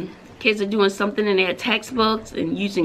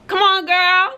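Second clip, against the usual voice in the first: excited greeting; 10 semitones higher, 5 dB louder, slower than usual. Real, not acted. Calling out to coworker.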